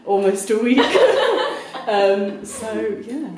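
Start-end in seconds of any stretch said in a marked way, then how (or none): 0.8-1.8 high laugh. Real, not acted. Laughing while introducing host.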